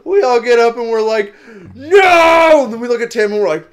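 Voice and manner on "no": a loud dismayed shout of no. Retold or imitated. Friends shouting at the burning restaurant. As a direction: yell it in horror.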